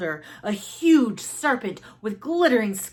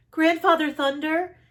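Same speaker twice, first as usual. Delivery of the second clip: high, loud plea to the sky; a desperate call upward; cried out; 8 semitones higher, 4 dB louder than usual. Acted. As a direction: call out for help. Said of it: "she cried out".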